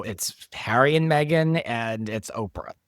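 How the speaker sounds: ordinary talk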